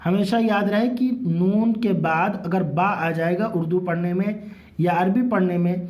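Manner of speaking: ordinary talk, nothing marked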